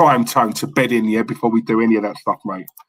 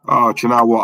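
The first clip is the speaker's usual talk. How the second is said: normal talking voice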